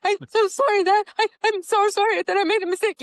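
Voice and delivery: mock bawling apology. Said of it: "I started bawling".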